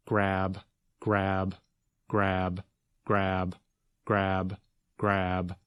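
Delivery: the same word in an even, level monotone; repeating over and over. Shown as a demonstration. Teacher showing how words sound funny, repeating monotonously. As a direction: repeat it identically, evenly spaced, like a loop. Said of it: "Oh, you mean like this?".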